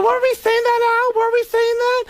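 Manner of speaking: high, eager woman's voice; Beverly D'Angelo impression. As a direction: chirp it up at Al, all innocence. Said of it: "Beverly D'Angelo".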